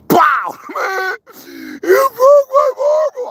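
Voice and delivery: high loud yelp, hammed-up; mock shout. Replaying a childhood marble fight, high mock wail. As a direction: yelp like a kid who lost his marble.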